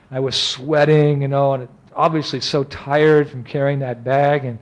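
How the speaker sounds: ordinary talk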